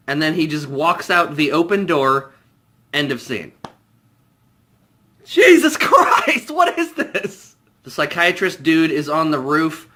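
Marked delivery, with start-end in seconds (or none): 5.2-7.5 much higher and louder, slow, with a laugh; stunned disbelief. Real, not acted. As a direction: cry out at the absurdity. Reacting to absurd scene.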